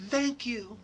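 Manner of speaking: teary thanks. Retold or imitated. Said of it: "like, crying, like, happy".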